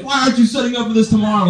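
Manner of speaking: high-pitched ranting yell at normal volume; mock road-rage yell. Driver railing at marathon crew from his car, mock raging yell. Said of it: "I'm yelling this through, across town".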